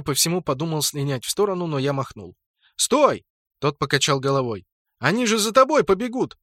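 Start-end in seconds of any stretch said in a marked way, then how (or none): 2.7-3.2 high, loud command to halt; urgent call. A narrator's reading. Narrator calls the orc back from ambush, urgently. Calling the fleeing orc to stop. 5.0-6.4 high-pitched insistent warning; urgent warning. A narrator's reading. Narrator warns orc he will be chased, urgently.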